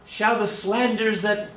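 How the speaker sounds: high, slow put-on lady; mock-formal lady, pitched well up; lady's voice. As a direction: read it high and drawn out as the lady. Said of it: "And she says".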